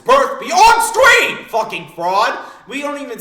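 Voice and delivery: loud, high, stretched-out shout; outraged rant yell. Real, not acted. Fan roasting off-screen Blackbeard, outraged rant yell. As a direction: blow up in exasperation.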